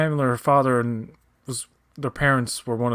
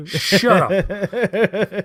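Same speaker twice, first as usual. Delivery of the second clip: slow, drawn-out higher put-on voice; mock character voice; 7 semitones higher, 4 dB louder than usual. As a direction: drag out shut up like a sneering character. Mocking the show's dialogue.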